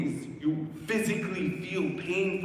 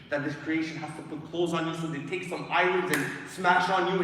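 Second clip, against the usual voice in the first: usual speaking voice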